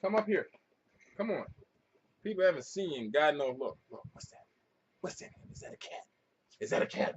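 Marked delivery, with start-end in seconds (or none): 3.6-7.1 soft teasing whisper; whisper to dog. Real, not acted. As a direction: hush it to get the dog excited. Teasing his dog about cat.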